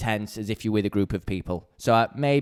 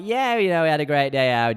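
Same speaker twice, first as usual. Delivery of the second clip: higher-pitched imitation of a pleased tourist; mocking chatty tourist; 4 semitones higher, 4 dB louder than usual. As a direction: play the self-satisfied holidaymaker, mockingly. Mimicking slum tour customers.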